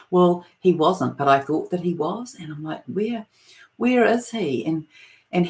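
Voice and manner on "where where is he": retold panicked searching. Panicked mother searching for lost son.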